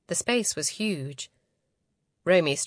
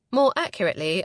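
usual speaking voice

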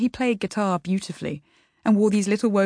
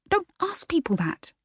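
hissed; 11 semitones higher than usual. Acted. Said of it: "she hissed".

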